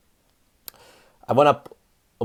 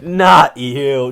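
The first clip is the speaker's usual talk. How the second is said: mocking repeat; 2 semitones higher, 8 dB louder than usual. Comics mocking him in car.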